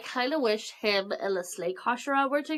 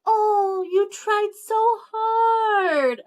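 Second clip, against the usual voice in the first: high, slow patronizing coo; condescending sing-song; 10 semitones higher, 5 dB louder, much slower than usual. Retold or imitated. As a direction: coo down at them sweetly. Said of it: "he's just like".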